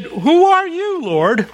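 high, slow, awestruck question; awed Paul. Acted. Paul, struck down, asks the voice.

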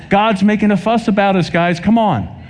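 high-pitched, urging preacher; insistent plea at a lifted pitch; urgent exhortation. Real, not acted. Urges an unresponsive congregation.

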